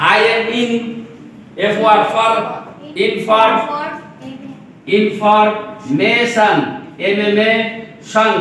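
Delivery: "Information" very slowly as in-for, pause, মে-শন; teacher sounding out "information" piece by piece, drawn out; split into syllables. Shown as a demonstration. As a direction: break "information" into slow, separate syllables. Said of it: "syllable ভাগ করে করে উচ্চারণগুলো শিখতে হবে".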